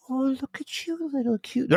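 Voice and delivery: high, soft cooing voice; naive scientist. Retold or imitated. Cohost imitating the doomed scientist, cooing softly. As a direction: coo at the alien like a pet. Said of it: "that guy in every alien movie that's like".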